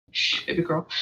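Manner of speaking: whispered shush to a pet; hushing whisper. Real, not acted. Kitsune player hushing her baby girl mid-game, whispered. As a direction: hush her gently under your breath.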